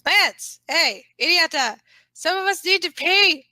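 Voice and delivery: loud, high impatient shout; impatient sibling shouting to get into the bathroom; yelling through the door. Acted. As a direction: bang on the door and holler at him.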